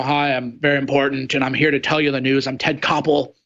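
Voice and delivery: mock the stuffy TV newsman who thinks every word is law; hammed-up solemn news anchor parody; pompous newsman. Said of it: "fake news people who are".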